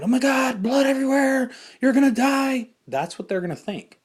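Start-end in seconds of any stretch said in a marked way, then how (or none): 0.0-2.7 high, loud frantic cries; mock panic. Warning viewers how a panicked rescuer sounds, shrill and loud. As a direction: play a rescuer out of his mind. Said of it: "out of your mind".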